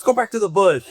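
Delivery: hammed-up retort from the bushes; mock snapping back. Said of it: "and then he goes".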